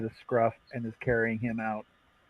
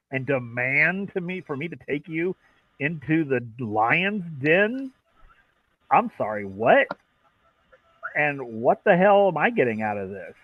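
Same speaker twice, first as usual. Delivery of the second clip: indignant mechanic; 4 semitones higher, 4 dB louder than usual. Acted. Mechanic refusing Talia's demand to go: higher indignant disbelief. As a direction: draw it out in disbelief.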